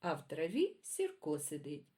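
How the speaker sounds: put-on lively sing-song poem reading for kids; reading poems to children. A narrator's reading. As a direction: read it like a bedtime rhyme for little ones. Reads children's poems aloud.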